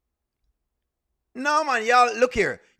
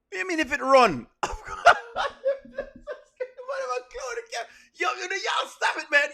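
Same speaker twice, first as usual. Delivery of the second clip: laughing shout; 10 semitones higher than usual. Real, not acted. Chat teasing him, laughing.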